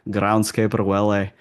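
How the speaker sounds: playful Scottish accent impression; Scottish groundskeeper. Accent coach showing off a favorite accent, Scottish impression. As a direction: imitate a cartoon Scotsman's accent. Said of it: "I love imitating".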